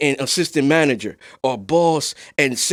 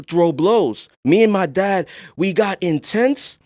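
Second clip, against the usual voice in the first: usual speaking voice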